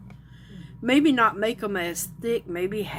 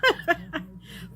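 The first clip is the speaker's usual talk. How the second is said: short laugh; 8 semitones higher than usual. Real, not acted. Amused at own measurement.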